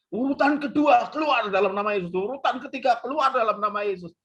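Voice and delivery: high, chanting roll-call command; mock exorcism. Friend expels demons in turn, high mock exorcism. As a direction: rattle off expulsions like a drill caller.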